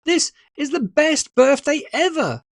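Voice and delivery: high, loud delighted cry; overjoyed. Acted. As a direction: burst out with joy. Child's joyful exclamation.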